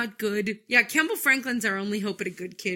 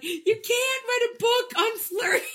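high, slow, laughing mock shout; mocking Miller; 13 semitones higher, slower than usual. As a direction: scoff at her idea through laughter. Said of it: "And Miller's like".

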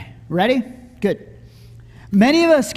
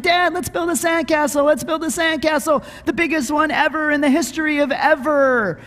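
put-on high-pitched, eager child pestering dad; excited kid; 5 semitones higher, faster than usual. Kids begging dad at the beach, excited and high-pitched. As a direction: beg like an excited kid, higher and insistent.

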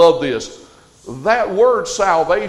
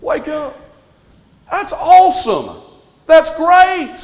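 much higher and louder, waking the crowd; rousing the crowd; 10 semitones higher, 7 dB louder than usual. Real, not acted. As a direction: fire up a sleepy congregation. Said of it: "y'all are a dead group".

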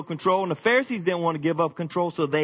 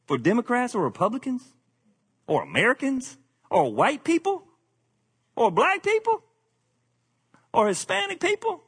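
preaching at full force; 11 semitones higher than usual. Real, not acted. Preacher to congregation, warning against loving politics over people, building to a high fervent cry. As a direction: drive the point home from the pulpit, climbing higher and higher.

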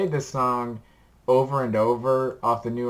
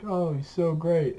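quoting others' gushing, higher pitched; gushing praise; 7 semitones higher than usual. Retold or imitated. Friends talking up an artist to a skeptic, gushing. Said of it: "talking up this artist, like".

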